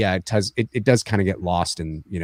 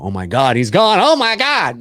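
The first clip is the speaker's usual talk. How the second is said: rising, louder, higher panic; panicked take; 7 semitones higher, 4 dB louder than usual. Shown as a demonstration. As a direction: play it freaking out. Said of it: "two ways you can play it".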